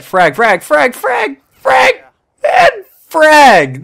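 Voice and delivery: rising excited frag calls; grenade warning yell. Real, not acted.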